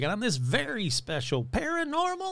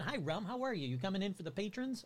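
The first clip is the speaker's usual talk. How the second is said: soft talk to the cat; 2 semitones higher, 11 dB quieter than usual. Real, not acted. Talking to his cat mid-recording.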